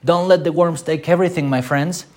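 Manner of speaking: a hammed-up Scrooge warning his friends. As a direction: play a grasping Scrooge rushing the line. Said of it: "Like Scrooge".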